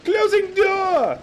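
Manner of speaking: very high, loud, slow yell; drawn-out yell. Real, not acted. Gamer announcing the door closing, drawn-out yell. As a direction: stretch every word out as you shout.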